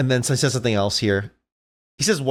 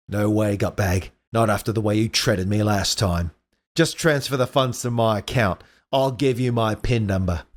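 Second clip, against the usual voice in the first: lower-set Australian weasel refusing a deal; badly faked Australian, a touch Cockney; bad Australian accent; 4 semitones lower than usual. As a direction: haggle in a dodgy Australian accent. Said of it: "that's a shitty Australian accent".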